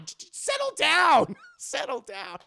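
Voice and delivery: high, loud squeaky settle down; mock settle down. A host squealing to calm the crowd.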